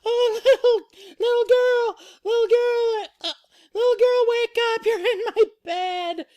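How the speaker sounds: squeaky, loud, insistent falsetto; Baby Bear. Acted. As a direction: badger the sleeper in a tiny high voice. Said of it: "Baby Bear still going".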